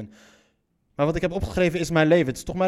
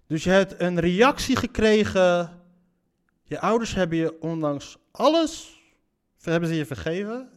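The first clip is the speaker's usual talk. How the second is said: ordinary talk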